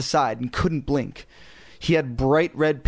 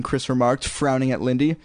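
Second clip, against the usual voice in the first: ordinary delivery, nothing marked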